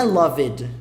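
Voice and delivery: "loved" as two syllables, "lov-ed"; wrong pronunciation. Shown as a demonstration. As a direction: sound out the E in "loved". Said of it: "a pronúncia é diferente também".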